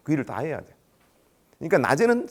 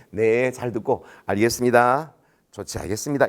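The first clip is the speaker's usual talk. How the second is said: slow, lower placating recital; scripted phone answer; 3 semitones lower than usual. Shown as a demonstration. Boss drilling rookie on the stock reply, placating. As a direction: draw out each polite phrase evenly. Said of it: "이 말만 하라고".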